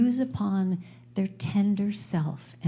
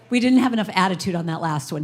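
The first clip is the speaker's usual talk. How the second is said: normal talking voice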